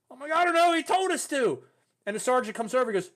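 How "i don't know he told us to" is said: high, loud flustered defence; retold excuse. Graduate answering Sergeant Stone, loud flustered excuse. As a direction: blurt the excuse in replayed alarm.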